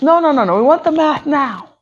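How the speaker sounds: insistent. Acted. Class insisting on math.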